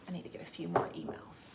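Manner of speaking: fast whisper to herself. Real, not acted. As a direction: aside under her breath. Muttering while reading.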